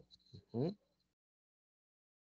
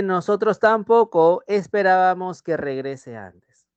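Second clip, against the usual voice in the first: "tampoco" leaned on, louder and slow; stressed tampoco; 5 semitones higher, 8 dB louder, slower than usual. Shown as a demonstration. Teacher reading the example aloud, stressing "tampoco". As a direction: say it slowly and loudly, hitting "tampoco".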